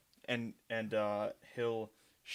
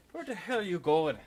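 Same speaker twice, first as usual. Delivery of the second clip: high, loud Irish leprechaun; 7 semitones higher, 7 dB louder than usual. Acted. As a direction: shout it like a cheeky leprechaun. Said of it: "I am still loving the leprechaun voice".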